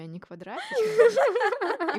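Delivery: squeaky high laugh through words. Real, not acted. Punchline of a funny story.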